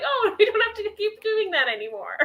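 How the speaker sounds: high, laughing through the words; laughing realization. Retold or imitated. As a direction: giggle it out like a happy discovery. Retelling own lighthearted realization.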